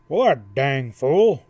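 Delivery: loud, slow, gruff outburst. A narrator's reading. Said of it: "burst out gruffly".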